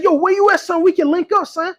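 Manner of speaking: put-on high-pitched New York accent; hammed-up New York slang voice. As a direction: imitate an eager New Yorker. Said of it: "my New York accent".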